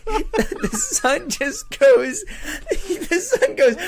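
very high, slow, helpless laughing speech; wheezing with laughter mid-sentence; real laughter. Real, not acted. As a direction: fight through the giggles to speak. Laughing hard while retelling.

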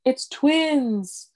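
retold announcement. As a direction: announce the surprise with a lift. Reading out announcement sign.

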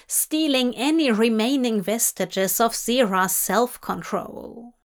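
plain reading voice

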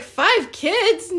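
high, loud incredulous echo; shocked disbelief. Retold or imitated. Hearing her crush has five kids, shocked. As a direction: repeat it back, stunned.

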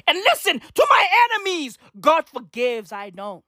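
high, shouted address to enemies; defiant call-out. Real, not acted. Calls out defiantly to his enemies.

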